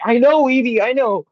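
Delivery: high, loud, overwhelmed outburst; panicky retort. Retold or imitated. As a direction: imitate someone frantic at sensory overload. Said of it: "like, almost a panic".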